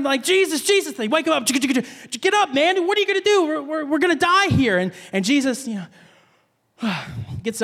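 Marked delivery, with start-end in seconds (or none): 0.2-0.9 higher, urgent acted cry; freaking out. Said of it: "you're freaking out". 1.5-4.8 high, panicky acted pleading; hammed-up frantic alarm; freaking out. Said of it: "you're freaking out".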